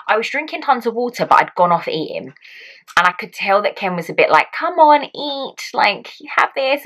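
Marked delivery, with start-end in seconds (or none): 4.5-6.8 higher-pitched mock coaxing partner; imitating encouraging husband. Husband urging his labouring wife to eat, mock coaxing. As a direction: chivvy her along like a cheery husband.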